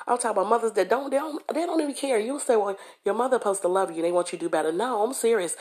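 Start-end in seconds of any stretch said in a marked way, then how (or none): none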